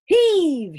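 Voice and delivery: big, high shouted heave. Acted. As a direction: heave him out with a full-voiced shout. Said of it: "shouted Father".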